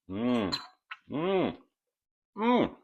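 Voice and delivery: rising, delighted mm of taste; savoring mm. Real, not acted. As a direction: hum up with pleasure at the taste. Tasting a Brussels sprout.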